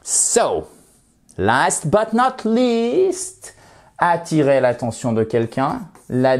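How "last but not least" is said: "last but not least" sung out high and slow; playful high announcement. Shown as a demonstration. Teacher playfully announces the final expression. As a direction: announce the last item with flair. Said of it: "playful announcement".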